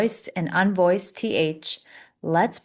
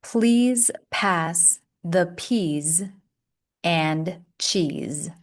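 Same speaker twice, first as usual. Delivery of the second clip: slow model reading; much slower than usual. Shown as a demonstration. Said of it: "Repeat after me".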